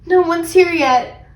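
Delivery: high, slow worried line; anxious child's remark. A narrator's reading. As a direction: fret it out slowly. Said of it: "I said anxiously".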